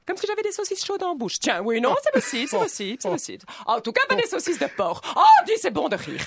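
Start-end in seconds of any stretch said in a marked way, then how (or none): 5.2-6.2 plus aigu et plus fort, ravie; s'esclaffer de sa blague; gleeful. Acted. Character laughs at own joke. (bourgeoise ravie de sa blague au téléphone)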